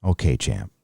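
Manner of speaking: put-on deep soothing dad reassuring his kid; best dad voice. Streamer shows his dad voice on request, low and soft. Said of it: "That's my best dad voice".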